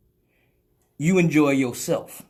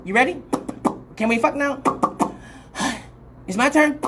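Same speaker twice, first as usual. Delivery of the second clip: impatient partner voice; 6 semitones higher, faster than usual. Retold or imitated. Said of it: "be down there like".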